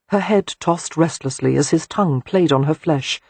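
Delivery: ordinary delivery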